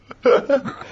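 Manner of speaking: a high airy burst of laughter; high breathy laugh. Real, not acted. Laughing at the barber joke.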